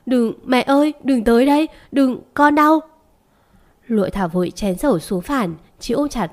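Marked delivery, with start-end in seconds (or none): 0.1-2.8 raised, louder plea building in pitch; terrified screaming. A narrator's reading. Sen begging her mother to stay away, frightened cry. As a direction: cry out like a frightened child, narrator's restraint. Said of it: "không ngừng run lên la hét".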